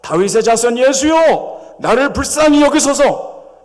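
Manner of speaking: high, loud, slow desperate plea. Acted. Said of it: "더욱 소리를 질러".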